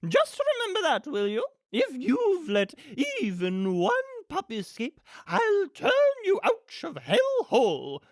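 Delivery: high, loud, imperious warning; swooping, theatrical villain voice; threatening villainess. Acted. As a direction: lay down the threat like a grande dame. Villainess threatens and dismisses henchmen.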